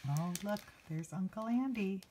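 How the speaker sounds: usual speaking voice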